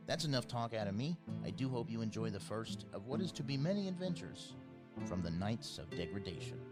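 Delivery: ordinary talk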